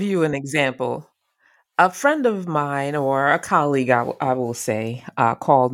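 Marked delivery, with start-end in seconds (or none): none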